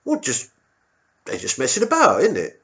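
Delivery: high, timid squaddie voice; a nervous young private answering his officer; meek soldier squeaking up to the colonel. Retold or imitated.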